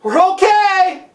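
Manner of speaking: high, drawn-out shout; calling up. Acted. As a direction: holler the all-clear to someone above. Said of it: "calls up".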